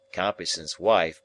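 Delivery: ordinary delivery, nothing marked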